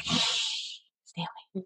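slow, hushed mock warning. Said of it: "And now I'm like".

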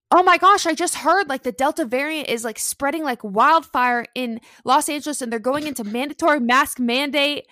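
high, loud alarmed voice of the mother-in-law; mother-in-law panicking about the Delta variant; mother-in-law on speaker. Retold or imitated. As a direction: blurt out the scary news in a rush of alarm. Mother-in-law's panicked phone news.

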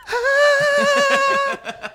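very high squealing scream; high-pitched scream. Retold or imitated. Shriek of pretend terror at a snake, very high. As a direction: shriek like a whistle tone. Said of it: "I'd been like".

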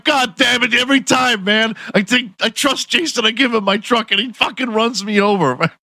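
put-on high, fed-up Jamaican-accented rant; exasperated villager. Run-over villager ranting at Jason, high mock Jamaican accent. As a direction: complain bitterly about being run over again. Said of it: "They're like".

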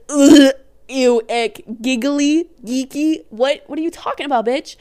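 loud mock disgust; disgusted guy voice. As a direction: sneer at it like a put-off guy. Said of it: "he would probably be like".

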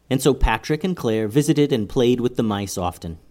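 ordinary delivery

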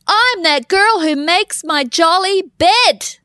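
slow, high proud declaration. Retold or imitated. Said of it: "I said it out loud, people".

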